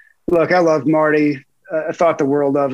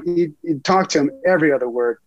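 ordinary talk, nothing marked

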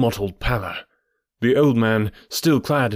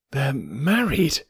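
slow, swooping disbelief; shocked gasp; 3 semitones higher, slower than usual. A narrator's reading. Narrator stumbling on the wedding, slow aghast gasp. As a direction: draw it out, aghast. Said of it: "I gasped".

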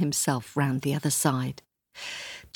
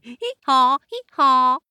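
much louder, higher laughing bray; 9 semitones higher, 10 dB louder than usual. Acted. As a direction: bray with glee.

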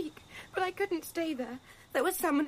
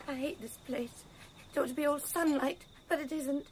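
usual speaking voice

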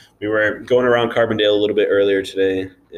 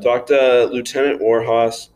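slow, louder plug; mock announcement; 4 semitones higher, 5 dB louder, slower than usual. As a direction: call it out like an ad. Announcing the recruiter, playful.

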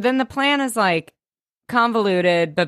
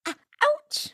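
mock ouch; 4 dB quieter than usual. Real, not acted. Joking about sharp jawline.

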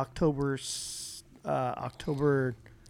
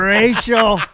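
loud singsong "racial!"; teasing call-out; 6 semitones higher, 16 dB louder than usual. Pretend. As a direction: ham up the teasing cry. Teasing chant after race joke.

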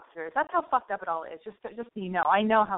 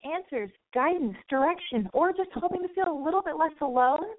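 high, punchy radio host pitch; over-the-top radio intro; radio show opener; 8 semitones higher than usual. Acted. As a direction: sell the show like a hyped late-night host. Radio host's overblown show opener.

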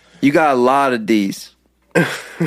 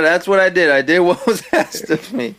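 ordinary talk

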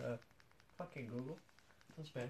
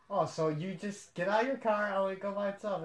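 mock incredulity; 5 semitones higher, 6 dB louder than usual. Mock amazement at self-parking car.

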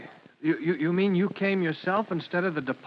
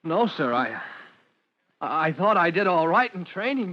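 ordinary talk

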